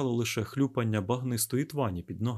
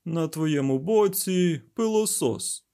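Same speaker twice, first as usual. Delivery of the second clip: slow, higher, mocking drawl; loudly scornful, stretched words; ironic; 6 semitones higher, 5 dB louder, slower than usual. A narrator's reading. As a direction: draw it out with sarcasm. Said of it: "іронічно сказав він".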